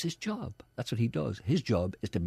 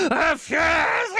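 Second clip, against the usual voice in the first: gasp, then a much higher, louder, slow hammed-up shout; hammed-up outburst of fury; mock furious shout; 6 dB louder, much slower than usual. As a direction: play-act rage at the top of the voice. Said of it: "Furious driving. ... that sounds great".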